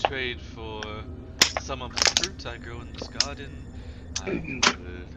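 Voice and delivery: soft, understated dryad voice; composed dryad. Acted. Dryad offers the adventurer a trade, soft and composed. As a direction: softly set out your terms as the dryad.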